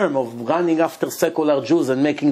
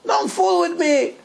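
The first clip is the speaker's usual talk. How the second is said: high, panicked shouting at the locked door; mock scream; 7 semitones higher than usual. Groom locked in by friends, shouting in panic. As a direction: yell it in disbelief, pitched way up. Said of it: "He screams".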